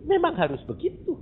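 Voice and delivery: ordinary delivery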